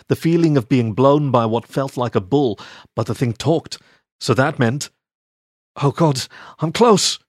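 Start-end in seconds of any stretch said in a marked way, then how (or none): 5.8-7.2 breathy rising moan, the pitch higher. Acted. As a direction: let the words climb into a moan. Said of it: "Sigmund moaned".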